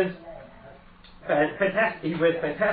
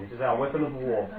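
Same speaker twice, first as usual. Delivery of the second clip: ordinary talk, nothing marked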